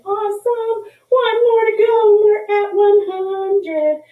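high, loud, cheerful sing-song; excited sing-song. Real, not acted. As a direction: cheer them on, half singing. Teacher cheers near one hundred.

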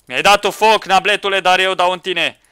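loud, high combat taunt; heated fight. Real, not acted. Taunting an opponent who set him alight, loud heat. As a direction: fire back at him.

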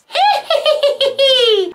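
high cackling laugh. Real, not acted. Laughs at her drooping brows, high cackle. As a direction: cackle out loud.

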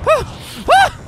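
high piercing panicked scream; terrified scream. Real, not acted. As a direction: shriek in real panic. Zombie chase, screaming.

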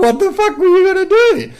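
high, loud exasperated cry; incredulous outburst. Retold or imitated. Vlogger retorting to a complaining fan, exasperated. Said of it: "I'm like".

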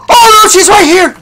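panicked shout. Real, not acted. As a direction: cry out that she is right there. Monster right beside him.

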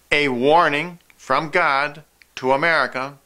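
ordinary talk, nothing marked

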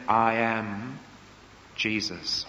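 low, hushed, solemn; divine voice. Acted. The voice from heaven.

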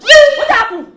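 high, loud echo of what happened; outraged shout. Acted. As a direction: throw the question back in fury. Mother mocking child's question angrily.

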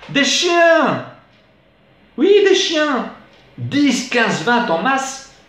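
very high, drawn-out cry of alarm; high-pitched comic panic; alarmed outcry. Acted. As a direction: cry out in theatrical disbelief. Recited alarm at pack of dogs.

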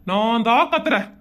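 low voice at normal volume; resigned admission. Acted. Husband taking blame from wife, resigned. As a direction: repeat the surrender audibly, pitched low.

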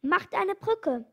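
rally everyone with urgent repeated calls; urgent shout. A narrator's reading. One monkey orders the others to act, urgent shouting. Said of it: "Da schrie einer der Affen".

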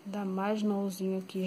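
drowsy, slow, half asleep; sleepy voice, dozing off; nodding off. Real, not acted. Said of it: "eu tô com sono que tô assim, chega cochilando".

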